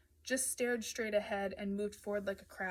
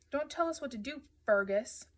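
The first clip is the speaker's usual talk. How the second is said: louder retort, leaning on the "Fur" of Fergus; drawn-out name; 5 semitones higher, 6 dB louder than usual. A narrator's reading. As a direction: stretch the first syllable of Fergus mockingly. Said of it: "Jesse drew out the fur part of my name".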